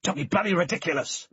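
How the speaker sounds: irritated snap; snapping. A narrator's reading. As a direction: snap back at him. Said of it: "Matt snapped".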